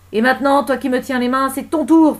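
loud, high, mounting fury; enraged shouted warning; menacing threat. Acted. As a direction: turn on your captor. Woman threatens her captor.

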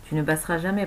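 plain reading voice

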